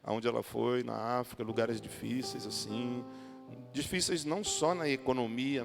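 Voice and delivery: normal talking voice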